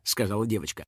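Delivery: usual speaking voice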